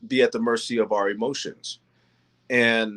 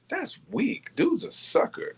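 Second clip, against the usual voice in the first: much higher, airy scoffing voice; mocking onlookers; 14 semitones higher, 4 dB quieter than usual. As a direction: scoff it off like an unimpressed bystander.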